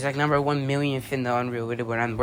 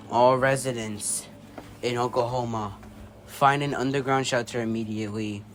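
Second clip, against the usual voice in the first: emergency broadcast; 3 semitones lower, 5 dB quieter than usual. Acted. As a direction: read it like an emergency siren broadcast. Emergency broadcast warning read.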